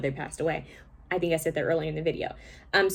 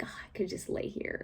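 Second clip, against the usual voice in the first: lazy whisper; 13 dB quieter than usual. Retold or imitated. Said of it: "but I felt like".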